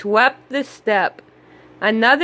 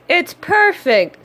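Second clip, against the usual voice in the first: delighted; 7 semitones higher than usual. A narrator's reading. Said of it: "new house is ready".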